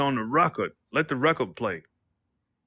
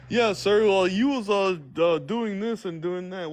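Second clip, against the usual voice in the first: mock officer reply; 7 semitones higher than usual.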